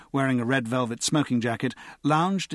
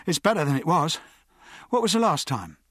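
higher-pitched, mildly hurt protest; wounded tone; 5 semitones higher than usual. A narrator's reading. Ager defending his run to Pierce, mildly wounded. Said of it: "in a wounded tone".

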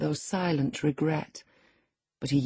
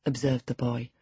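usual speaking voice

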